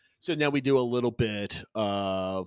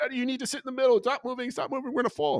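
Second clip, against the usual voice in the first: put-on high, loud whiny child voice, much higher; imitating yelling daughter; 13 semitones higher, 4 dB louder, faster than usual. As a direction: mimic a bossy little girl scolding dad. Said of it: "started yelling at me through the whole trip".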